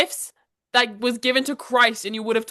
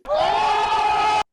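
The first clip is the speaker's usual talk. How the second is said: shocked gasp; 12 semitones higher than usual. Retold or imitated. After grandma tells her to slow down, shocked gasp. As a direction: gasp as if insulted.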